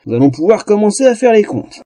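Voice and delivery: sly, threatening smirk; smug, with a smirk. Acted. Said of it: "commença-t-il avec un sourire narquois".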